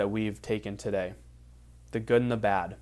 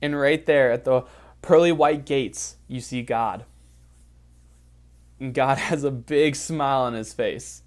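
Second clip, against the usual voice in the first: soothing guided imagery narration; guided visualization; 3 semitones higher than usual. Acted. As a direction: lead them gently through the vision.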